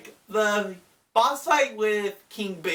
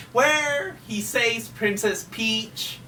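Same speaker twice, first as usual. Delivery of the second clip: ordinary talk, nothing marked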